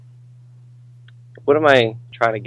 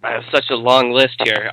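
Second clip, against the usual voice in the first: normal talking voice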